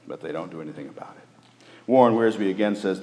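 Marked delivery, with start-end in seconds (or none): 0.1-1.3 quick soft whisper; whispered and fast; hushed. Real, not acted. Sermon point delivered hushed.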